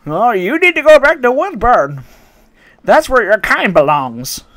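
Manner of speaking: high, loud mock old lady rant; hammed-up furious granny yelling about jobs. Racist customer voiced in story.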